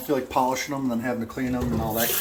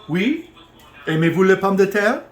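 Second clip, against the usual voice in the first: higher, louder playful French; mock French; 6 semitones higher, 7 dB louder than usual. Home cook playing French host asking about potatoes, mock French. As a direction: show off your French to the guests. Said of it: "I can speak French too".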